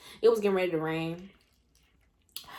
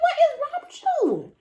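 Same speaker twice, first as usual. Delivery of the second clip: high, loud, slow mock outrage; shrill mock outrage; 8 dB louder, slower than usual. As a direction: drag out the scolding, high and loud. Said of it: "I'm like".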